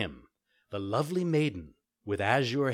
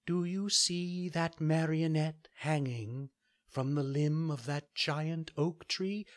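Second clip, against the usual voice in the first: high, gentle fairy voice; a kind fairy giving a calm order; 6 semitones higher, slower than usual. Acted. Fairy speaks in story.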